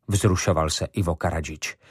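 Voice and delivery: plain reading voice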